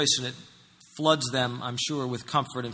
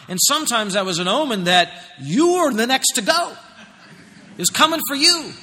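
high, loud, animated sermon storytelling; full-force preaching; 7 semitones higher, 7 dB louder than usual. Real, not acted. Preacher telling of disciples terrified on the sea, full-force preaching. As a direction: preach the scene with a raised, urgent voice.

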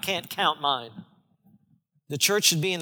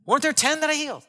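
much higher, fast puzzled question; Jesus asking; 8 semitones higher, faster than usual. Acted. Jesus wondering where the lepers went, puzzled question. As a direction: rattle it off in disbelief. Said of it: "he said".

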